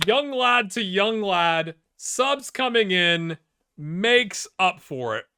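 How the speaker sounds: loud, drawn-out commentary; triumphant goal call. Real, not acted. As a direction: stretch each phrase out, high and proud.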